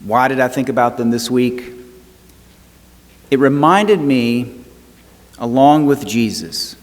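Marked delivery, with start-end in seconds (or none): 3.3-6.8 rising preacher's cadence with long pauses; a pastor turning a wedding story to Jesus, preaching with building fervour. Acted. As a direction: preach it, lifting the congregation.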